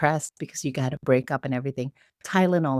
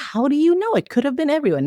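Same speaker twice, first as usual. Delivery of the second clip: much higher, louder protest; indignant disbelief; 12 semitones higher, 4 dB louder than usual. Retold or imitated. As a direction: protest your innocence in disbelief. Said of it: "And I was like".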